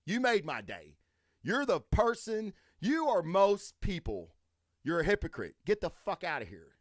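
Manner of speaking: angry shopper mocking the woman, sarcastic; sarcastic sendoff. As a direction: drip sarcasm, then snap. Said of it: "I'm like".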